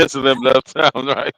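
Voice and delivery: chuckling through the words; laughing. Real, not acted. Repeating the joke with amusement.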